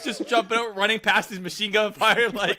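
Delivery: high, lively, laughing storytelling; amused excitement. Real, not acted. Picturing a tennis player charging the S S, giddy excitement. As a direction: get carried away by the absurd picture.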